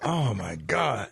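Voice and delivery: low, slow mock gasp of shock; deadpan dismay, low and drawn out. Host reacts to crash story.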